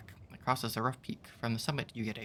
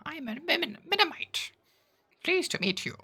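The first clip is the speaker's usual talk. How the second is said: much higher, chirpy little creature voice; tiny creature voice; 3 dB louder than usual. Acted. Tiny creature Jan greets the traveler in a high squeak. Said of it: "I'm gonna stop this voice".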